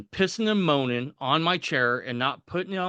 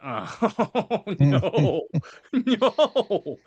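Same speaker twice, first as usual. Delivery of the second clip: high laughing protest, half-joking; a giggly high-pitched oh no; 6 semitones higher, 4 dB louder, much slower than usual. Retold or imitated. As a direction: squeal a laughing refusal. Laughing mock refusal of calling.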